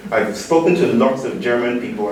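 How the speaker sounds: normal talking voice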